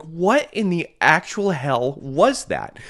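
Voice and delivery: high, loud, slow disbelief; stunned. Retold or imitated. Telling the healer, loud slow stunned disbelief. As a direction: drag it out in shock. Stunned after allergies vanished.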